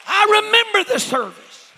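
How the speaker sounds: high, loud cry of testimony; full-force preaching. Acted. Preacher opening his testimony to the church, full-force preaching. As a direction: call it out high over the congregation.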